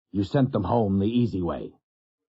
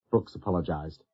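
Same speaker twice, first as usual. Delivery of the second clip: plain reading voice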